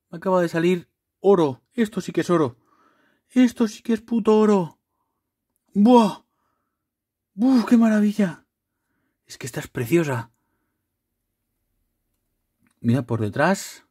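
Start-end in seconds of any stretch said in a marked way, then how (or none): 0.1-8.4 high, excited gushing; thrilled. Real, not acted. Showing viewers a gold card, high excited gushing. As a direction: rave about the gold card, breathless with joy. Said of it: "Me he emocionado".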